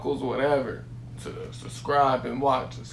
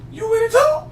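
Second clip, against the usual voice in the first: high, loud put-on cop voice; mock cop asking with a rising end; imitating the cop; 8 dB louder than usual. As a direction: squeak it up like a friendly cop. Imitating the cop's greeting.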